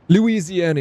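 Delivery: "Louisiana" blurted out high and loud on remembering; eager recall. Real, not acted. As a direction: burst out with "Louisiana" as the name finally comes. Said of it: "what's the states?".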